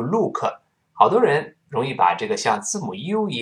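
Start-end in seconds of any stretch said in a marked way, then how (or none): 0.0-0.4 "look" with a Chinese-style long oo; wrong pronunciation. Shown as a demonstration. Teacher warns learners off this sound, wrong pronunciation. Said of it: "注意发音不要读look".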